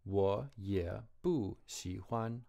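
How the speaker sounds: 我也不喜欢 said very slowly; each tone drawn out; slow Chinese demo. Shown as a demonstration. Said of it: "here's a rule with any third tone".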